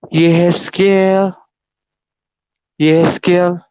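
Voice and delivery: slow, stretched-out calling of a name; big, gentle voice. Retold or imitated. Mysterious voice calls him by name in church, big but not harsh. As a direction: imitate a vast voice calling without shouting. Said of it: "suara yang begitu besar, tapi tidak keras".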